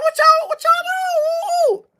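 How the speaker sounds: high, slow outraged shout; a stunned, high-pitched cry of protest; shocked yell. Retold or imitated. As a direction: yell at the cops in disbelief. Arrested man yelling at cops.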